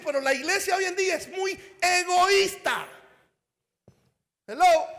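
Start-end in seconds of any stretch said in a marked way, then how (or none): 0.0-2.9 rushed, forceful preacher's line; said too fast. Shown as a demonstration. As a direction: fire it off quick and loud. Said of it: "tal vez lo dije muy rápido". (dicho demasiado rápido y fuerte)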